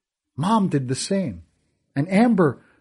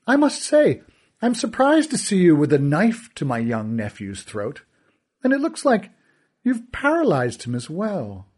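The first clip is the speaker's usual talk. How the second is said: smug villain; 5 semitones higher than usual. Acted. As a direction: purr the lesson with a smile. Kealty mocking voice.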